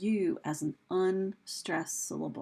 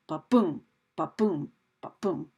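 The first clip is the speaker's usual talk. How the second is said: "ba-boom" with a weak BA and a punched BOOM; low-pitched ba-BOOM, ba-BOOM, ba-BOOM; heartbeat rhythm; 4 semitones lower, 5 dB louder than usual. Shown as a demonstration. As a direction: beat out ba-BOOM like a pulse, pausing between beats. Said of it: "like the beating of a heart".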